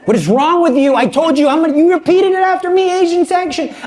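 dad who told him twice, losing patience; exasperated dad. Retold or imitated. Exasperated dad scolding son.

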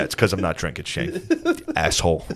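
low, muttered mock contempt; imitated surly comeback; angry sarcasm. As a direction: grumble it sourly, dropping off at the end. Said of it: "He's always so fucking angry".

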